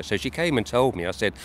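ordinary talk

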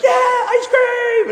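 high, slow delighted cheer; cheering kids. Retold or imitated. Children cheering for ice cream, high delighted squeal.

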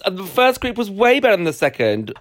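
high-pitched, incredulous outburst; real, scandalised disagreement; shocked disbelief. Real, not acted. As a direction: burst in, astonished at that opinion.

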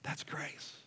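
whispered softly, almost breathless; hushed whisper. Real, not acted. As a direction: let the word grace fall into a whisper. Pastor stating grace, hushed.

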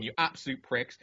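ordinary delivery

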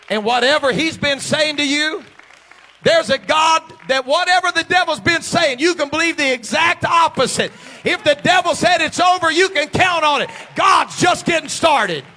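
loud, high rallying shout; full-force preaching. Real, not acted. Preacher rallying believers against the devil, full-force shout.

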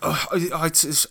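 usual speaking voice

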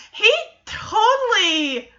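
ordinary talk, nothing marked

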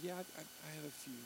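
very soft, stammered admission; sheepish. Retold or imitated. Student admitting to an interviewer what he memorized, sheepishly. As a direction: shrink back and admit it haltingly. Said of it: "he was kinda sheepish about it".

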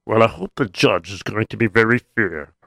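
plain reading voice

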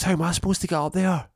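very high, loud, fast; panicked question. Retold or imitated. Stranded below the hole, panicked and high. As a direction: blurt it in panic.

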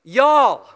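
"y'all" said loud and drawn as one Texan syllable. Shown as a demonstration. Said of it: "To speak a little bit of Texan".

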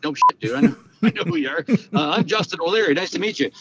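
giddy nervous hello, half laughing; starstruck excited greeting. Retold or imitated. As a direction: gush like you just met your hero. Meeting Michael Jordan, starstruck retelling.

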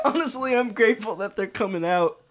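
high laughing squeak. Acted. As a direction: giggle the word out. Laughing line.

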